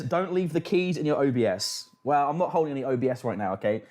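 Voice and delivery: ordinary talk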